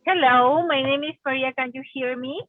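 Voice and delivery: usual speaking voice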